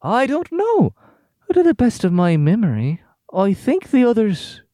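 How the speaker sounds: high, slow and unsettling; sing-song creepy calm; eerie high voice. Acted. Creepy woman character speaks.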